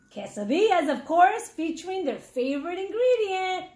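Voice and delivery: ordinary delivery, nothing marked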